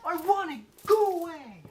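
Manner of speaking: put-on very high, loud, whiny kid; bratty child. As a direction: kid yelling to keep the toy. Children quarrel over toy.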